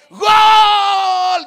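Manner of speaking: huge high drawn-out yell; roaring, long-held goal cry; stadium goal shout. Acted. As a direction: scream it like a fan in the stands. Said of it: "dicen en el estadio".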